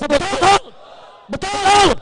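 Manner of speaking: loud, high, drawn-out call; rousing call. Real, not acted. Orator asks the rally to agree, rousing shout. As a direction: demand the crowd's answer.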